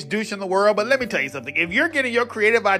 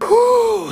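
mock exhausted whew; 11 semitones higher, 6 dB louder than usual. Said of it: "Already out of breath".

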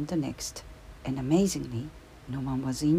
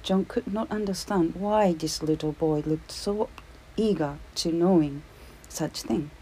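ordinary delivery, nothing marked

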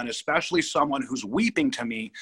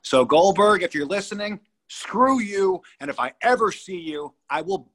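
higher and louder, jabbing at Goldberg; angry callout; 7 semitones higher, 3 dB louder than usual. Real, not acted. Telling Goldberg off on air, angry and raised. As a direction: fire it straight at him.

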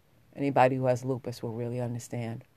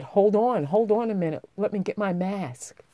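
quick, high and loud hold on; hurried plea to wait; urgent call; 7 semitones higher, 3 dB louder than usual. Retold or imitated. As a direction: call out to stop him leaving. Calling out to the neighbor kid.

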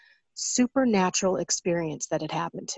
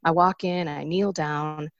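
normal talking voice

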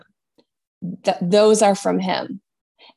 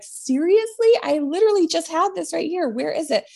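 much higher, playful mock disbelief; laughing and joking; 8 semitones higher than usual. A grieving client laughing about lost keys, playful and high. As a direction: toss it off amused at losing the keys again. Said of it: "like, laughing and joking".

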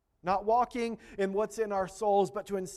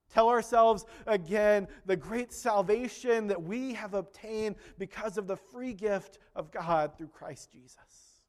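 usual speaking voice

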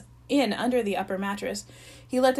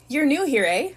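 higher, louder cheery question; bright; 5 semitones higher, 6 dB louder than usual. Acted. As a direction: beam at the new kid. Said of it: "said Dave brightly".

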